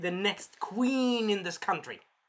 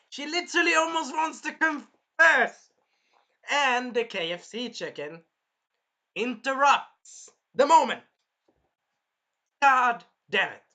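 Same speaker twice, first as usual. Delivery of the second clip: high, drawn-out frustrated shouting; loud, high and stretched with exasperation; 8 semitones higher, 10 dB louder, slower than usual. Real, not acted. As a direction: cry out slowly in dramatic outrage. Exasperated retelling of interrupted confession.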